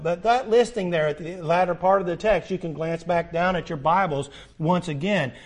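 ordinary talk

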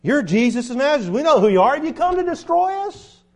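high, loud demon shout; demon crying out. Retold or imitated. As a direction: cry it out like the possessed man. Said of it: "and he cries out".

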